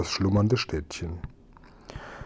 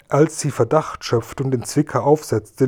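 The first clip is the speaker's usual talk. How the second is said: plain reading voice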